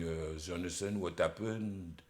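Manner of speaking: put-on faint, slow, low French-accented groan; barely audible, eyes closed, French accent; collapsed French conductor. Collapsed conductor on his couch to the singer, groggy French accent. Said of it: "His eyes are firmly closed".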